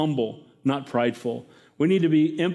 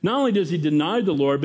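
normal talking voice

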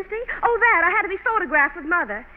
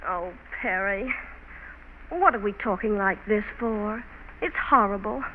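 tender apology; 8 semitones lower, 5 dB quieter than usual. Acted. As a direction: gently take back the harsh words. Lovers make up after quarrel.

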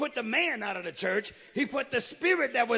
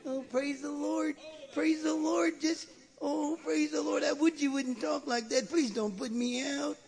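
higher, sing-song mock pleading; pleading demon, mocked; 3 semitones higher than usual. Demon begs not to be put out of church, mock pleading. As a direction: imitate a demon begging to stay. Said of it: "What did he say?".

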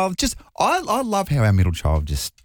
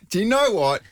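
high, breaking with laughter; helpless laughter; 12 semitones higher than usual. Real, not acted. Host cracking up at the kindy story, laughing. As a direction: try to talk through the giggles.

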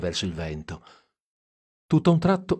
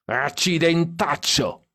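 put-on drunken yell; 8 semitones higher, slower than usual. A narrator's reading. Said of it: "urlò Stan dietro".

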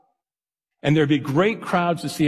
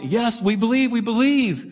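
high, excited crowd chant; eager crowd cheering; 8 semitones higher than usual. Retold or imitated. Crowd at Niagara cheering Blondin on, high and excited. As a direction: cheer it like a thrilled crowd at the falls. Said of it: "He'd work the crowd".

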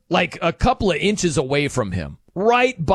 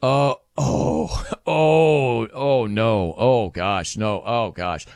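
mock horrified realization, sinking lower; acted dawning dismay; 5 semitones lower, much slower than usual. Pitcher learning how his word was taken, acted dismay. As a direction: let the imitated "oh no" sink in, pitch dropping.

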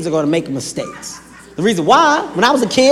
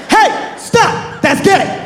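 high, sharp "Hey, stop!"; high sharp parental callout; 10 semitones higher, much slower than usual. Pretend. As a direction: snap in to stop a kid mid-act.

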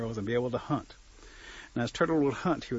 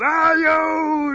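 loud, very high yowl; hammed-up high-pitched ouch; acted yelp of pain; 11 dB louder than usual. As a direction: cry out like a turkey hit in the leg. Turkey cries out, hit in leg.

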